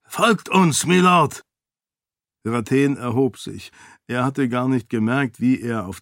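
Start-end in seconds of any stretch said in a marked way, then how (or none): 0.0-1.4 high, loud call. A narrator's reading. Guards at the gate call the lord to follow. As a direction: call him through the gate.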